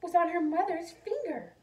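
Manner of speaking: a storyteller gasping at the ring; gasping surprise. A narrator's reading. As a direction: breathe it out in shock. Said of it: "Then she gasped".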